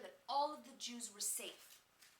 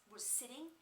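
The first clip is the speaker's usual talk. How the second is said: plain reading voice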